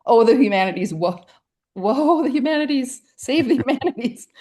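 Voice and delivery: high, loud theatrical wail laughing through it; mock lament. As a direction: ham up a tragic cry for the humanities. Mock lament over the humanities.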